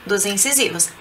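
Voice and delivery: normal talking voice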